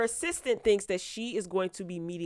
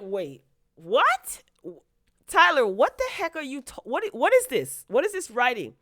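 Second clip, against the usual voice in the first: higher, louder disbelief; exasperated outburst; 6 semitones higher, 5 dB louder than usual. Real, not acted. Ranting at the writer Tyler, loud and incredulous. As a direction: blow up at the writing. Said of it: "I'm like".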